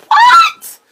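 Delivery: a high, squealing burst of surprise with a laugh; startled laughing what. Real, not acted. Reading a shocking chat comment, she squeals in laughing surprise. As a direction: caught off guard, she yelps it through a laugh.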